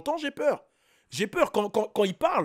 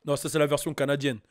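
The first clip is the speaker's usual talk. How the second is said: normal talking voice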